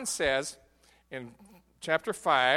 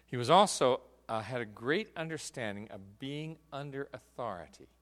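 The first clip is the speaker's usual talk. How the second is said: normal talking voice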